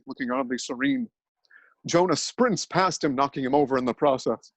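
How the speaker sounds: ordinary delivery, nothing marked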